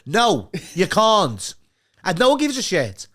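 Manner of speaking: loud, high, drawn-out mock outrage; exasperated retort. Scouser answering pestering Southerners, loud exasperated put-down.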